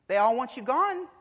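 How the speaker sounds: loud, high pulpit cry; pounding sermon voice; full-force preaching. Acted. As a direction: drive it home to the congregation.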